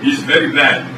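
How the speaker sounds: broken-English Russian host, deadpan; mock Eastern European TV host; fake Russian accent. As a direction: play a stern Russian cook in broken English. Boris sketch continues.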